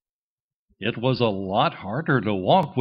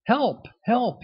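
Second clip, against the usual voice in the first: much higher, frantic calls; a sinking duckling calling for help; desperate cry for help; 11 semitones higher than usual. A narrator's reading. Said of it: "he cried".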